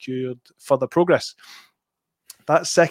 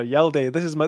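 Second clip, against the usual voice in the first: usual speaking voice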